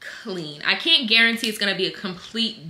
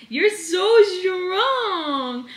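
tender praise; 9 semitones higher, much slower than usual. Real, not acted. Praising baby.

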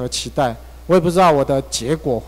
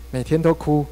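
ordinary delivery

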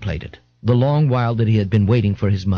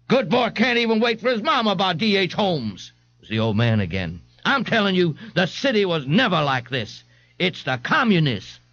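put-on high, quavering old man's voice, pitched up; 8 semitones higher than usual. The old man blames the communists to the crowd, high and quavering. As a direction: grumble like a cranky old-timer.

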